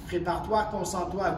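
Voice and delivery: normal talking voice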